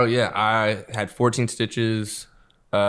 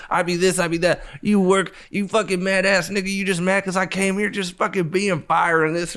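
taunting rant; 8 semitones higher than usual. Retold or imitated. He taunts the bald bouncer, high and mocking.

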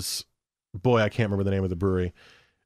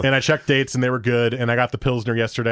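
ordinary delivery

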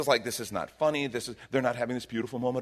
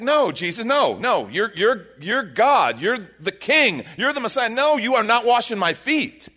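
louder, higher earnest protest; protesting Peter; 7 semitones higher, 6 dB louder than usual. Retold or imitated. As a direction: insist like a flustered disciple refusing. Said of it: "It's like".

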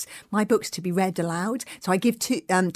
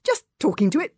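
awkward, embarrassed reply; embarrassed mumble; 6 semitones higher than usual. Acted. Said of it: "mumbled Hiccup, very embarrassed".

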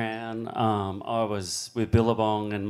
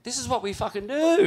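much higher, insistent; fired-up insistence; 12 semitones higher than usual. Retold or imitated. Arguing with the officials who forbid going out, fired-up. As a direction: push back hard and proud. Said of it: "we're like".

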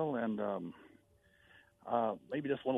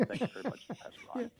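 much higher, joking put-on thank-you; playful put-on voice; 12 semitones higher, 3 dB quieter than usual. Caller thanking the pastor, joking high put-on voice. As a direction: toss it off in a silly high voice. Caller jokes after country remark.

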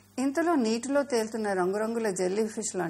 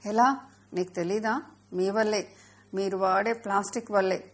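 ordinary delivery, nothing marked

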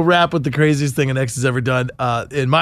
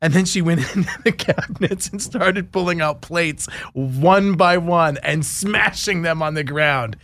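normal talking voice

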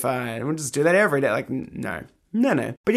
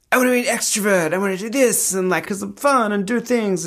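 high, quick, eager to please; bubbly and trying too hard; 8 semitones higher than usual. Retold or imitated. Her old eager self voiced.

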